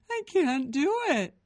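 high, loud wail; crying child. A narrator's reading. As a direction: wail helplessly. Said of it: "Gabe cried again".

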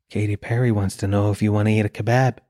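low, deadpan app message; food app notification. Acted. Delivery app asking a dieter about kebabs, low and deadpan. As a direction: read it low, like a creepy prompt.

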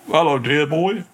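Mister Pond's voice. Retold or imitated. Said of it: "He said a voice like that".

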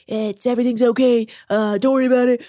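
half-hearted attempt at the president; badly done presidential impression; Donald Trump. Host showing co-host what he said, poor Trump impression. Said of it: "I can't do a very good Trump impression".

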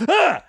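imitated shocked shout, pitched very high; a short mock scream of surprise; startled yell. Yankem startled, kicking door.

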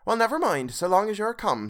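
a mother brushing off the lateness; Mrs Cratchit voiced. A narrator's reading. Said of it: "said Missus Cratchit".

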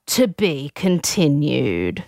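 low, slow announcer; imitating a portentous cliffhanger narration; mock voiceover. Osher closing the episode on voiceover, mock solemn. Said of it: "Osher comes on voiceover and says".

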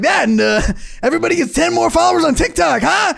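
put-on pander to the kids, overdone; mock politician. Podcaster mocking Newsom pandering to teens, hammed-up shout. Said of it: "He's like".

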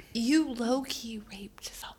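hushed, voiceless whisper; whispered aside. Pretend. Telling co-host a dark aside, whispered. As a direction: drop to a conspiratorial whisper. Blunt aside about the character.

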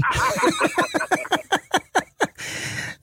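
high, breathy belly laugh; long laugh. Real, not acted. Storyteller after his dad's punchline, long high laugh. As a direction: crack up at your own story.

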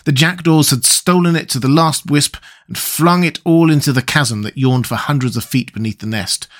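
ordinary delivery, nothing marked

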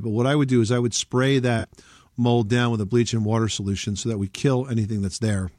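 ordinary delivery

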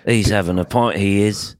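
light attempt at a British accent. Shown as a demonstration. Co-host offering British instead of Irish, light British accent. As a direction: try a rough British accent. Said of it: "I could do it, like, British".

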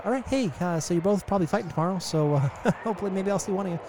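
lightly voiced stoned guide; a hint of a spaced-out stoner. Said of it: "and he says".

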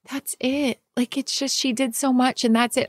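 a touch of retold tears; husband crying after saying goodbye to surrogate; tearful. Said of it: "he was just crying".

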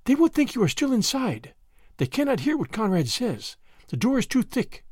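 put-on high, light girlish voice; lightly voiced girl explaining in secret; mild whispered explanation. A narrator's reading. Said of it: "Annette explained in a whisper".

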